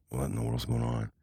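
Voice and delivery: quiet, breathy aside to himself; low, softly baffled murmur; hushed bewilderment. Retold or imitated. Boy in bed wonders as brother gets up, hushed bewilderment.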